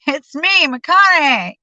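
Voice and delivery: calling out loud through a door; yelled. Retold or imitated. Calling his name at Don's front door, loud. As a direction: raise the voice to be heard through the door. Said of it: "I yelled".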